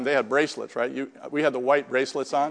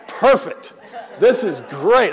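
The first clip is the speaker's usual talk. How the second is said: loud, higher burst of happy relief; retold delight; 4 semitones higher, 6 dB louder, slower than usual. Newcomer told he can drink weekends, retold as overjoyed. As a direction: exclaim it like the deal of a lifetime. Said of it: "I was so happy".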